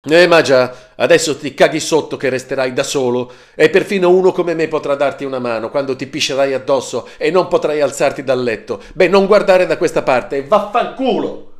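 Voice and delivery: very loud, high screaming abuse. Acted. Marco leaving the flat: very loud high screamed insults. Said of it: "continuando a strillare come un pazzo".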